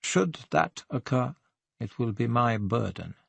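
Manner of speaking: deliberate, self-righteous restraint; hard, cold reply. A narrator's reading. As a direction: answer with restrained hardness. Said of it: "her eyes hardened".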